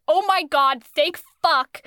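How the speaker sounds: emphatically acted relieved outburst; mock relief. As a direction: gush it like a rescue has come. Voicing friend's first thought, relieved.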